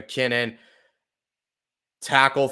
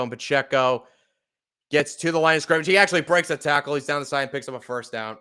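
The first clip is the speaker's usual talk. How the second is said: louder, higher play-by-play call building with the run; live play-by-play; 4 semitones higher, 6 dB louder than usual. Acted. Fan narrating the Chiefs drive for viewers, energetic play-by-play. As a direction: call the drive like a broadcaster, picking up pace at the end.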